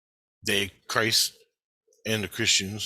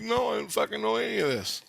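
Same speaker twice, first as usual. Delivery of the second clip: baffled; 10 semitones higher than usual. Retold or imitated. Retorts to Tina, baffled.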